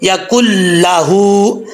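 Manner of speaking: या कुल लहू slowly, नून dropped, कुल joined to लहू; no नून, stretched हू; correct slow reading. Shown as a demonstration. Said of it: "ऐसा नहीं पढ़ना".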